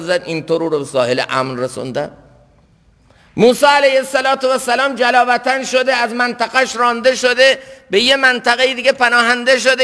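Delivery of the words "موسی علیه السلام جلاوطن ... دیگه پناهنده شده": high, sustained sermon voice; impassioned preaching. Real, not acted. Preacher recounting Moses' exile, impassioned. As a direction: pile up the hardships on a raised pitch.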